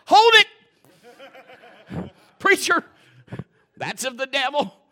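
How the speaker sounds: laughing, high, loud mock protest; hammed-up outraged congregant; mock outrage. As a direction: cry out like a startled churchgoer. Congregation objecting to offering.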